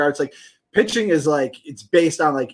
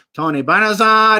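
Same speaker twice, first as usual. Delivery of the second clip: nasal Omar Minaya voice; slow nasal drawl of the name; Omar Minaya impression; 5 semitones higher, 4 dB louder than usual. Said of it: "like, his, like, nasally voice".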